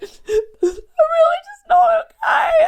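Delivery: high, loud sobbing cry, words slowing; the voice cracks into crying on the phone to mum; breaking into sobs. Retold or imitated. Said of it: "started to bawl my eyes out".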